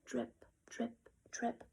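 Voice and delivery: soft whispered drip drip drip. A narrator's reading. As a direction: let each drip fall softly. Bucket dripping sound.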